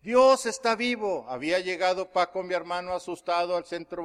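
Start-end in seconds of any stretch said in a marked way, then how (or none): 0.0-1.2 proclamación lenta que sube al repetirse; grito recreado. Retold or imitated. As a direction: imitar gritos espaciados, el último más agudo. (grito recreado, lento y creciente)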